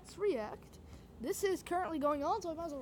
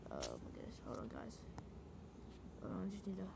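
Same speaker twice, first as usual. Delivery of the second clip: 1 quiet aside; 12 semitones lower, 13 dB quieter, faster than usual. Real, not acted. Fumbling with stream settings.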